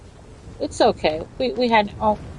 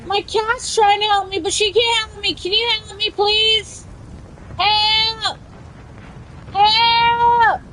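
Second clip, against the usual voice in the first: pitched-up pleading for help; high desperate plea; 10 semitones higher than usual. Pretend. Streamer begs teammates while her cat comes over, high plea. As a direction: beg the teammates in a high wail.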